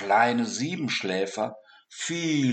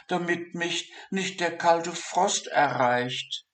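a lightly raised, fretful dormouse thinking aloud; worried little dormouse; 7 semitones higher than usual. Acted. Dormouse Siggi frets about digging his burrow, worried.

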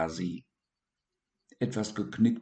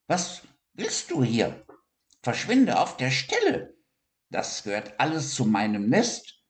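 higher, gruff hedgehog snapping; unfriendly hedgehog; 5 semitones higher than usual. Acted. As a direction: chase him off the nest. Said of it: "nicht gerade freundlich hervor".

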